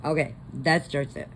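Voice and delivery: normal talking voice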